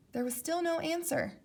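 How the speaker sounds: ordinary delivery, nothing marked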